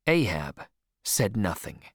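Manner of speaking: plain reading voice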